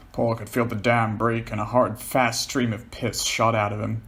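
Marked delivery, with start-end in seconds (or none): none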